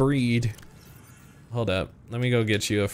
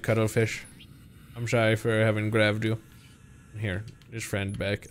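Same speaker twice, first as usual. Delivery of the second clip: normal talking voice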